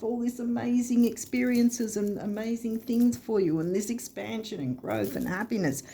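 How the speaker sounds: high-pitched, eager voice of beckoning energy; mock urgent plea. Energy calling the listener to work with it, excited high plea. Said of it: "energy that's screaming at you".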